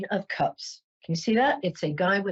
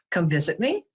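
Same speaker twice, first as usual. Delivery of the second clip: ordinary talk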